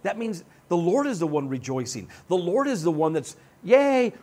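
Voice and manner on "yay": mock cheer. The Lord rejoicing.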